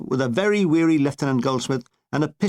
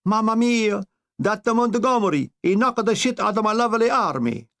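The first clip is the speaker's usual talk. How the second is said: raised, hammed-up Italian accent as a wailing dictator; Italian dictator lamenting his army, in a raised mock Italian accent; Italian Mussolini; 4 semitones higher than usual. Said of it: "Mussolini has just been told".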